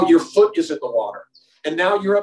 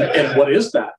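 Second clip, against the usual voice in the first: ordinary delivery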